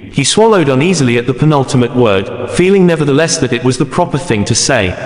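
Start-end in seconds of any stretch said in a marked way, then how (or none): none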